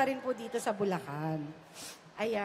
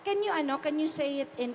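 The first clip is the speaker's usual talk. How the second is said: usual speaking voice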